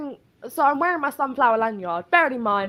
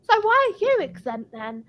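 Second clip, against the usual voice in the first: mock customer voice; 9 semitones higher than usual. High mock of a customer questioning her mask exemption. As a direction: squeak it like a prying customer.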